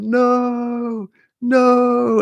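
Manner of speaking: a doomed guard squealing at a steamroller; panicked henchman. Retold or imitated. Retelling a movie guard facing a steamroller, high panicked squeal.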